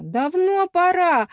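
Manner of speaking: high, drawn-out cheer; crowd's cheer. A narrator's reading. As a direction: call it out like a supportive crowd. (одобрительный возглас)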